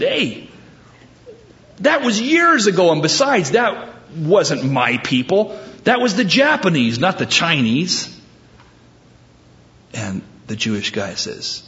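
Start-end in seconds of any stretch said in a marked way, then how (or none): 0.0-8.1 high, emphatic mock outrage; indignant protest. Punched man disowns the blame in a joke, indignant. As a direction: argue back, pitch leaping on key words.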